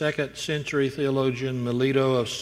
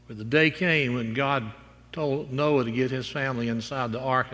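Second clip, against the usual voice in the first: ordinary talk, nothing marked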